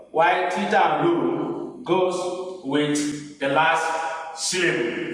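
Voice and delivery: normal talking voice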